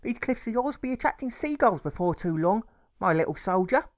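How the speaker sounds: high, loud, fond mother's voice; doting mother. A narrator's reading. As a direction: tease him like a loving mum. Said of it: "she says as she picks his clover".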